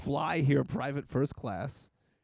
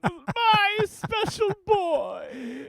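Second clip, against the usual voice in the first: very high, gushing mock-mother voice; doting falsetto; slower than usual. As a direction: coo over a special boy, slowing and stretching the end. Playing co-host's doting mother figure.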